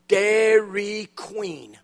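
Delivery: slow, drawn-out local answer; a slow, unhurried reply to the visitors' question; slow and natural. Retold or imitated. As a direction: stretch it out slowly and deadpan. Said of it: "Just say it slowly, naturally".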